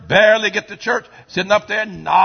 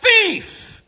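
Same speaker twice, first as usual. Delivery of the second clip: high shouted accusation; accusing cry; 3 dB louder than usual. Real, not acted. Preacher branding the sinner, shouted high. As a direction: hurl the word at them.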